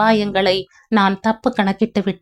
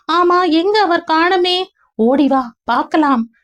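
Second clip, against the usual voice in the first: higher, dreamy female voice; young woman Leena voiced; 6 semitones higher than usual. Leena recalling a past life to Ganesh, in a higher woman's voice. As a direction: voice Leena as she drifts back to a half-remembered past.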